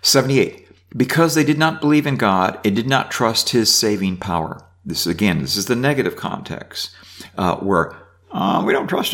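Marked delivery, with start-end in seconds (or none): 1.0-4.6 raised, measured Bible reading; reading scripture aloud. Acted. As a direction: read the psalm out to the class. Reads psalm aloud.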